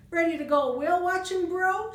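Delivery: put-on high, loud, fast California surfer accent; cute California accent. Cherie greets old friend Bubba, California accent. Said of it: "in a cute California accent".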